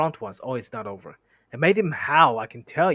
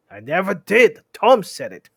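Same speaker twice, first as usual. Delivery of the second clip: high, loud troll protest; quarrelling troll voice; indignant denial; 6 semitones higher, 11 dB louder than usual. Acted. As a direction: deny it hotly and loudly. William denies it in quarrel.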